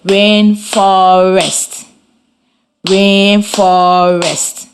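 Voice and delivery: RAIN-FOR-EST spaced out slowly, a clap on each syllable; teacher modelling the syllables. Shown as a demonstration. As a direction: sound out Rainforest in three slow beats. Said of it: "while I clap the syllables in the word".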